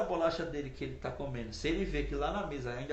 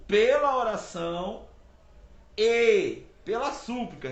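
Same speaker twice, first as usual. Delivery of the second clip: pela oração e pela súplica, slow and louder on each word; stressing oração and súplica; 3 semitones higher, 7 dB louder, slower than usual. Shown as a demonstration. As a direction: hit oração and súplica slowly and loudly. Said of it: "oração é uma coisa, súplica é outra". (ênfase lenta e forte em oração e súplica)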